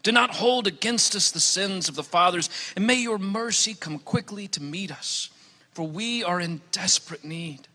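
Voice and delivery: usual speaking voice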